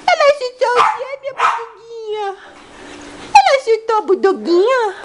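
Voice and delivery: high cooing pet voice; sing-song baby talk to the dog. Acted. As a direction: coo up high at the puppy like it's a baby.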